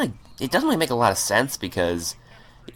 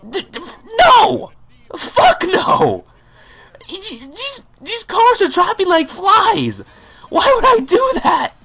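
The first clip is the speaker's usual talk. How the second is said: much higher and louder, a mock terrified squeal; panicked shriek; 5 dB louder, slower than usual. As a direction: screech it like you're scared out of your mind. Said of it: "like, terrified".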